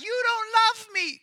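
very high, slow whiny woman; girly voice. Acted. Delilah pleads with Samson, in a slow high pouting voice. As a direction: drag it out in a pouting falsetto. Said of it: "I don't have a very good girly voice".

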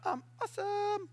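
high, soft sing-out brag; playful self-admiring squeal; mock boasting. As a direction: preen in a put-on high voice. Mock boastful response of Jesus.

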